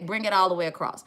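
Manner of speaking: ordinary talk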